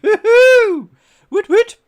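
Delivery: high, loud celebratory woohoo; excited whoop. Real, not acted. Streamer cheering a solved clue, loud excited whoop. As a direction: cheer it out at the top of your voice.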